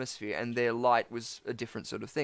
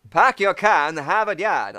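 put-on Boston accent; 4 semitones higher, 7 dB louder, slower than usual. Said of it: "Boston accent".

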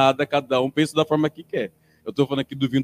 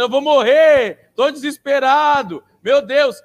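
higher, louder, slow panicked wail; mock panicked cry; 9 semitones higher, 6 dB louder, slower than usual. Passengers in turbulence crying out they will die, mock panic. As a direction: act out a passenger screaming in despair. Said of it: "O avião vai ter pessoas gritando".

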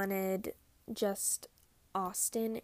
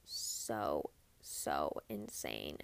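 low drawn-out awe; 3 dB quieter, much slower than usual. Real, not acted. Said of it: "The amount of fear".

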